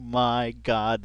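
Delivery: ordinary talk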